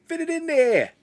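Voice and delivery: high, loud Boston ad guy; local ad voice. Retold or imitated. Video store ad guy in a thick Boston accent. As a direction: belt it like the guy in an old local ad. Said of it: "the guy comes up and goes".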